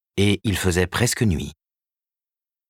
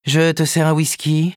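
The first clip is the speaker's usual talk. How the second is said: higher, businesslike woman's voice; lightly voiced Karen, brisk agent; 10 semitones higher, 4 dB louder than usual. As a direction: report the news briskly. Karen offers a drink.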